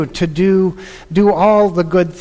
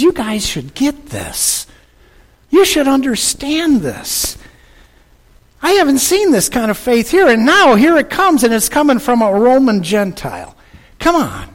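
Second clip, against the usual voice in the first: higher, faster, delighted Jesus voice; higher, louder voice full of pleasure; Jesus, pleased; 6 semitones higher, 3 dB louder than usual. Acted. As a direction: let Jesus sound thrilled and amazed. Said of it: "the tone of Jesus' voice".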